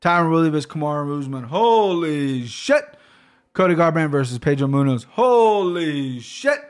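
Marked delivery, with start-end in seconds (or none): 1.4-2.8 slow, stretched-out holy shit; drawn-out awe. Real, not acted. Podcaster reacting to each fight on the card, slow and awed. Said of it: "I can't keep doing that". 5.1-6.6 slow, stretched-out holy shit; drawn-out awe. Real, not acted. Podcaster reacting to each fight on the card, slow and awed. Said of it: "I can't keep doing that".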